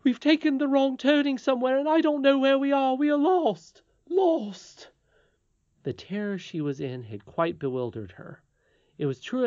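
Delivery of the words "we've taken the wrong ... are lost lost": high, loud panicked wail; great cry. A narrator's reading. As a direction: cry out in terror as a frantic nurse. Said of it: "gave a great cry, and said".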